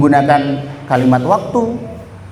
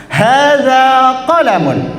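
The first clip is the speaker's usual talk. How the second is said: higher, drawn-out demo; slow model phrase; 6 semitones higher, much slower than usual. Shown as a demonstration. Teacher repeating after the class got it wrong, slow. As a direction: lean on qalamun slowly. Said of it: "Itu kan ada di tulisan".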